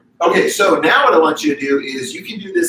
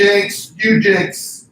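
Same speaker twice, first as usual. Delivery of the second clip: loud playful jinx call; 6 semitones higher, 5 dB louder, much slower than usual. Real, not acted. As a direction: shout jinx back at her. We said it together.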